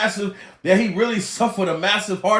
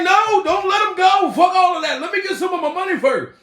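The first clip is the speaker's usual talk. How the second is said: higher, loud mock outburst; victims' mock protest; 9 semitones higher, 3 dB louder than usual. Victims demanding their money before he dies, mock protest. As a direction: cry out in mock alarm, pitched high. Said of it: "They be like".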